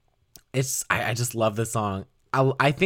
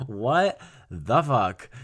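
stunned slow reaction; much slower than usual. Real, not acted. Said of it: "reacting to lyric".

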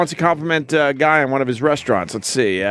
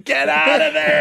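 high, slow mock yell; 10 semitones higher, slower than usual. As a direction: yell it like a frantic warning. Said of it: "they're screaming".

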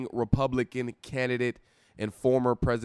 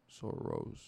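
much lower and softer; quiet aside; 15 dB quieter than usual. Pretend. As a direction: drop the name in a low mutter. Repeats name quietly.

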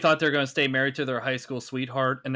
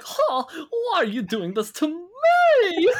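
higher, slow, whiny llama voice; sad llama voice; 5 dB louder, slower than usual. Pretend. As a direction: play a wounded llama, slowly.

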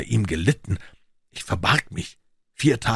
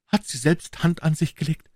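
much higher, anxious; stammering question; 9 semitones higher than usual. A narrator's reading. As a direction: dread what the answer is. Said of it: "stammelte er". (ängstlich stammelnd, viel höher)

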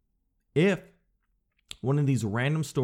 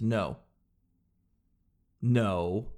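a firm "No." dropping low; decisive low no; 4 semitones lower than usual. Shown as a demonstration. Turning down the clerk, decisive low no. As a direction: close it off with a low "No.". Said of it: "See how it's decisive".

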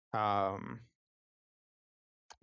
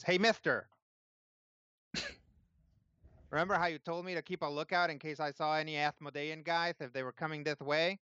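put-on higher, quick lisping kid voice; little tiefling boy; 6 semitones higher, faster than usual. Tiefling boy warning Pixo of men coming, lisping kid voice. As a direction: play an eager little boy with a lisp. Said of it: "a little tiefling boy runs in".

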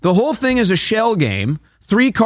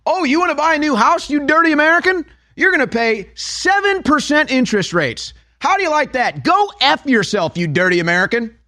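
mock sneer; 7 semitones higher than usual. Voicing sneering nato toward Americans.

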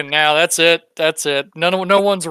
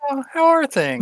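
sly greeting; 11 semitones higher than usual. Acted. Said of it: "be like".

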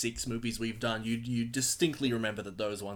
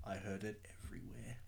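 whisper; 3 semitones lower, 14 dB quieter, faster than usual. Pretend. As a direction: fade to a breathy whisper. Fading echo to whisper.

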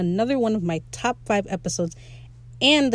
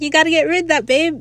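sweet urging; 7 semitones higher, 4 dB louder, faster than usual. Retold or imitated. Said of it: "And Pete's like".

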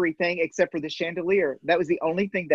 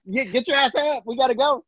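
a lightly voiced mom yelling to get up, higher pitch; mama calling; 7 semitones higher than usual. Mom waking her daughter for the job, mock mama call. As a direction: imitate mom hollering up the stairs. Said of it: "Mama's calling".

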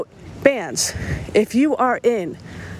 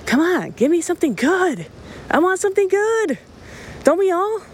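high, eager plea for good music; rising excited demand; excited pleading; 9 semitones higher than usual. Real, not acted.

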